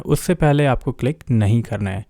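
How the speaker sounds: ordinary talk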